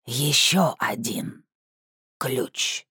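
slow, creaking doll voice; talking doll. Acted. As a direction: creak it out like a wind-up mannequin. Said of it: "Скрипучий голос куклы произнёс".

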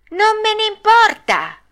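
very high, loud bratty squeal; escalated defiance. Acted. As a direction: shriek the retort like a puppet in a tantrum.

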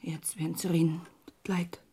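hushed, breathy fear of gossip; whispered worry. Acted. Widow fretting to her neighbour in a hushed whisper. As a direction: whisper it, afraid of being overheard.

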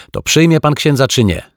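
put-on high, loud altar boy question; insistent asking. A narrator's reading. Altar boy pressing the detective for an answer, insistent.

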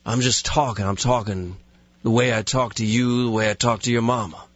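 lazy, deadpan in-character explanation; self-serious tough guy with loose words; Steven Seagal impression. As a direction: talk as if there's no effort at all. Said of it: "when I am Steven Seagal".

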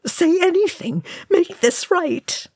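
very high, desperate plea; anguished cry. A narrator's reading. Oin imploring Thorin to speak, anguished cry. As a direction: cry it high, begging for help. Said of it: "Oin cried in anguish".

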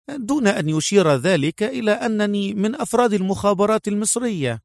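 high, measured woman's character voice; composed female officer, pitched high; young female agent, explaining. Acted. Voices Mona briefing her colleague.

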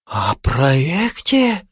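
slow, wondering echo; puzzled surprise. Acted. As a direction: repeat it slowly in bewilderment. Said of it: "удивились листья". (удивлённо, протяжно)

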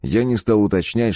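usual speaking voice

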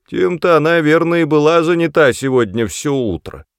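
high, loud spiteful crone; gloating old woman. Acted. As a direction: snicker it out with malice. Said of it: "злорадно хихикнула старая бабка".

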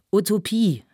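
loud, high call from the crowd; shouted heckle. A narrator's reading. Voices in the assembly call back, loud heckle. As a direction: throw the word back loudly. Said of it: "riefen einige Stimmen".

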